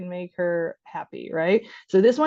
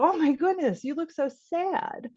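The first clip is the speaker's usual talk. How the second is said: sympathetic; 8 semitones higher, slower than usual. Acted. Parent approaching an upset child, sympathetic. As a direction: coo it with exaggerated concern.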